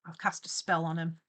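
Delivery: ordinary talk, nothing marked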